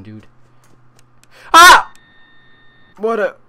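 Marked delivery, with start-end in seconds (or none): 1.5-1.9 startled scream. Real, not acted. Jump scare in game.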